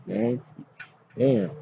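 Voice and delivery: ordinary talk, nothing marked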